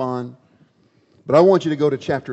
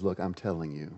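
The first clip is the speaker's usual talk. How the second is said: low, soft and steady assurance; much softer, lower reassuring voice; calm God; 4 semitones lower, 4 dB quieter than usual. Acted. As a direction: settle him down gently. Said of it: "He says".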